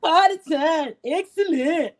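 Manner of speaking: Wayne's World quote. Retold or imitated. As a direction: hype it up like Wayne and Garth. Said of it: "Mike Myers and Dana Carvey".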